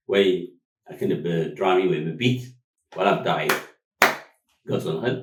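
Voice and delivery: usual speaking voice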